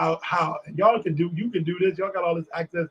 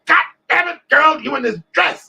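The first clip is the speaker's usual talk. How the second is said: loud, high audience holler; retold shout; 9 semitones higher, 8 dB louder than usual. Man in the front row hollers at the singer, shouting. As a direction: shout it out like an amazed heckler.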